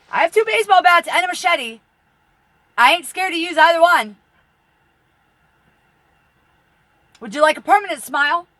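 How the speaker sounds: loud, high furious threat; menacing shout out the window. Real, not acted. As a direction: yell at the drones outside. Threatening drone operators.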